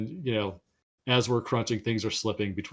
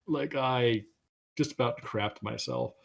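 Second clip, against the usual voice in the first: ordinary delivery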